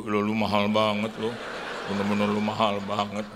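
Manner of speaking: mock outrage at the price tag; father staggered by the cardigan price; shocked at price.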